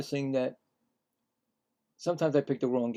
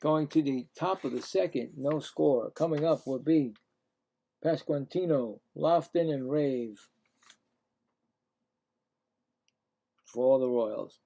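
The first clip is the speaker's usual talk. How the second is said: steady game call, a little raised; announcer voice for the at-bat; play-by-play announcer; 4 semitones higher, 3 dB louder than usual. Acted. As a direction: call each pitch like the broadcast booth. Calling batters and pitches.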